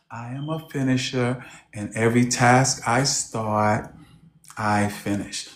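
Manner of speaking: low, soft, timid mumble of the line; meek imitation. Meek client repeating the coach's line, low and soft. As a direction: say it like you barely believe it. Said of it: "She would say".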